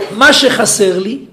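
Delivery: loud, slow, hammering each phrase; full-force preaching. Acted. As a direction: preach it out to the hall.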